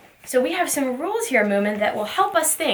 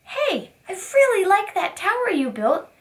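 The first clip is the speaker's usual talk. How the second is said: put-on much higher, bright friendly child voice; cheerful kid asking; 7 semitones higher than usual. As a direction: ask like an eager classmate. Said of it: "someone asks".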